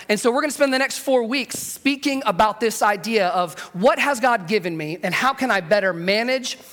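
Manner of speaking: ordinary talk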